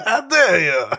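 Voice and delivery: high, loud indignant cry; outraged shout. Retold or imitated. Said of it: "And they're like".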